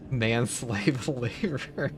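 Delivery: slow, laughing through the words. Real, not acted. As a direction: chuckle it out, drawn out. Laughing at his own remark.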